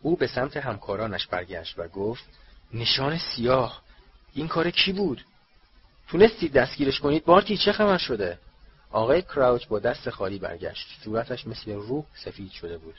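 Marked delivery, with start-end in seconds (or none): 2.7-8.4 breathless, rising higher and louder; alarmed questions tumbling out; agitated. Acted. Breathless Bagman demands answers.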